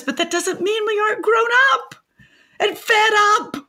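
high-pitched, exasperated protest; indignant. Acted. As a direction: climb high in righteous exasperation. Indignant rant about the holiday.

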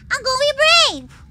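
summoning courage out loud; bold final resolve. Real, not acted. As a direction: burst out with resolve at last.

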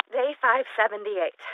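usual speaking voice

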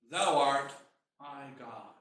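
low, trailing reverent murmur; quiet, low prayerful declaration; hushed confession. Acted. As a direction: say it softly, sinking almost to nothing. Preacher voices the people's humble confession.

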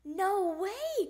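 high, loud refusal; protesting cry. A narrator's reading. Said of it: "cried Froggy".

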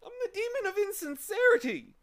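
very high, loud tearful confession; hammed-up blubbering creature; sobbing. A narrator's reading. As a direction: sob it out in a high wail. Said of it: "he sobbed".

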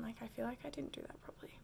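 whispered aside to herself; quick embarrassed whisper to the side. Real, not acted.

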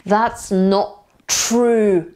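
slow demonstration of "That's not true" with no t ending "not"; dropped t. Shown as a demonstration. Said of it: "To ty w wymowie tylko zniknie tutaj".

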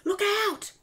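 high, loud warning shout. A narrator's reading. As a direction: yell a warning to friends. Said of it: "shouted Harry".

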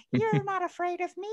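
a huge guy with a tiny high voice; tiny giant squeak. Retold or imitated. Host imitates a giant named Tiny, squeaky high voice. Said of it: "Tiny in a film has, like, a really high voice".

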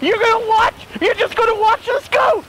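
high, worked-up fight talk; combative challenge. Acted. Santa challenging onlookers to fight, combative.

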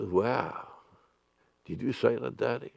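put-on soft, hushed child asking; quiet wide-eyed child; small child. Child voiced in the sermon.

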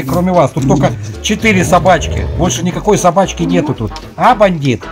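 usual speaking voice

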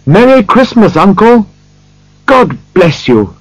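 high, cheery festive call; jubilant, high-voiced nephew; happy cry. A narrator's reading. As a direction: call out the greeting joyfully, pitched well up. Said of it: "cried a happy voice".

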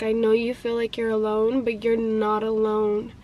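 low, soft consoling voice; tender retold reassurance. Friend comforts woman who feels alone, gently reassuring.

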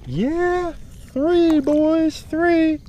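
high whooping count of fish; excited cheer. Real, not acted. Celebrating third fish caught.

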